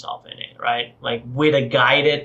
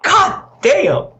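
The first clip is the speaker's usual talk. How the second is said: high loud exclamation; a sudden amazed yell; amazed shout; 5 dB louder than usual. Real, not acted. Reacting to the swallowing noise.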